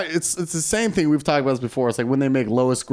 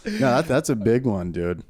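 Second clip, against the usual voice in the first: ordinary talk, nothing marked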